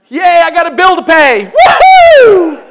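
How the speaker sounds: loud, high sarcastic cheer over a bill; mock cheer. Preacher mocking joy in trouble with a loud cheer. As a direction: cheer like a bill is great news.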